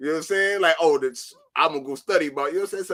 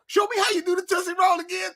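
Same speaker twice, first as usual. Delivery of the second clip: much higher, fast, hammed-up shouting request; mock women's voice; faster than usual. Women in the green room begging for a dance, squealing high. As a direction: squeal it like a pushy fan. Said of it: "they talking about".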